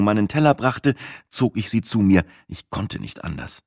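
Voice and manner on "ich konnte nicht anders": low, near-whispered admission; hushed. A narrator's reading. Narrator admits he pulled the waitress close, hushed. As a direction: drop to a soft confession. Confidential aside leading into whispered apology.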